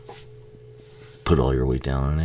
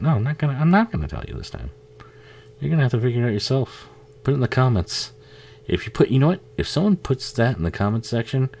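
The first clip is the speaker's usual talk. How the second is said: teasing refusal; 10 semitones higher than usual. Retold or imitated. Imitating friend refusing to tell.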